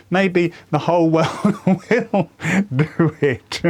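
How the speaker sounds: laughing. Real, not acted. Joking about the world after his death.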